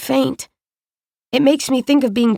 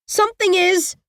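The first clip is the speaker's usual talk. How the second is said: put-on frightened girl; 8 semitones higher than usual. A narrator's reading.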